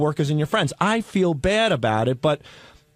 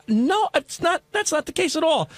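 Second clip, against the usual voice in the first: high defensive protest; 11 semitones higher than usual. Retold or imitated. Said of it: "I'm like".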